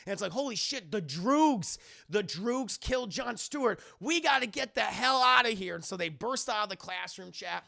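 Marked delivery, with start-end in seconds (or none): 0.3-5.8 high, urgent exclamation; acted alarm. Students fleeing the classroom, acted alarm. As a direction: raise pitch and urgency as if fleeing. Acted panic after kill.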